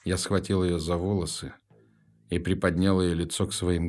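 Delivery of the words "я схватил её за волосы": ordinary delivery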